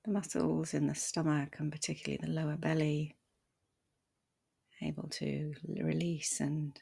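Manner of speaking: soft, unhurried meditation voice with long pauses; guided meditation. Acted. Meditation teacher guiding listeners to settle the body, softly and calmly. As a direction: ease them gently into stillness, leaving space between phrases.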